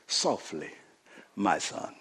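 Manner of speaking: hushed, gentle reply to a son; soft fatherly. Retold or imitated. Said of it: "He says".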